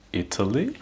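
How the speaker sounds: ITALY curving upward to a high finish; rising intonation. Shown as a demonstration. Teacher isolating the last word, rising intonation. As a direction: lift the end of ITALY like a question. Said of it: "Notice the last word".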